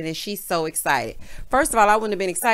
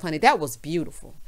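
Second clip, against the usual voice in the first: normal talking voice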